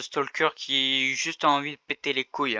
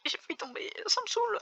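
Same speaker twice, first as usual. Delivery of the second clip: laughing; faster than usual. Real, not acted. Laughing after almost falling.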